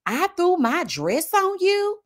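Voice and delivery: lightly voiced LaRon snapping back; imitating LaRon. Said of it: "LaRon said".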